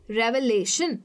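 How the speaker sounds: "Revelation" with LAY brought out; reveLAtion, second-last syllable stressed. Shown as a demonstration. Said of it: "ले पे ज़्यादा stress".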